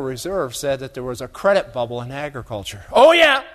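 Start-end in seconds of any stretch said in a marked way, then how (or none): 2.9-3.4 exclamation. Acted. Mock alarm to the audience.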